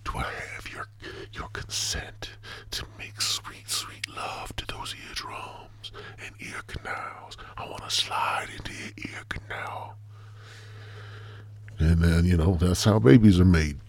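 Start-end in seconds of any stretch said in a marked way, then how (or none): 0.1-10.0 mock seductive whisper; hammed-up soothing ASMR whisper. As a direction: whisper it close into the ear, teasing. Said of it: "I'm gonna be like".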